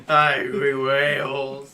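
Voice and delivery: loud laughing agreement. Real, not acted. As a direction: laugh out the punchline. Laughing joke reply.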